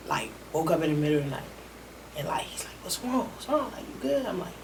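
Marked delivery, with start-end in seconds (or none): 2.8-4.3 soft concerned asking. Retold or imitated. Retold friend waking him at night.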